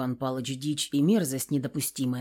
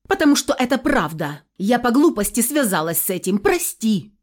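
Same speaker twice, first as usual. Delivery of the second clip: higher, louder cried-out confession; sudden furious outburst; 4 semitones higher, 6 dB louder than usual. A narrator's reading. Daughter admitting her mistake to her father, crying out furiously. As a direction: cry it out heatedly, pitch raised. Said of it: "С неожиданной яростью выкрикнула она в ответ".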